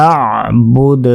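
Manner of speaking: আ'বুদ ending in an echoing dal; আ'বুদ with qalqalah. Shown as a demonstration. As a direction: bounce the final dal in আ'বুদ. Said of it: "কলকলা কিন্তু অবশ্যই করতে হবে".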